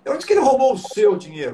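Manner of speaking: quick, with "seu" stressed. Shown as a demonstration. Coach demo to viewers, stress on "seu". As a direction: hit "seu" hard. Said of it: "se não foi o seu".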